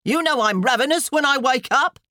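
high, loud demanding wife; bellowing. A narrator's reading. Hungry wife shouting at her husband, bellowing. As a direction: bellow it from another room. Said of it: "sounded a nearby bellow".

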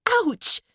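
put-on very high girlish yelp; yelp of pain. A narrator's reading. Daughter hit in the eye by goop, yelping. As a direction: yelp as if stung. Goop hits girl's eyes.

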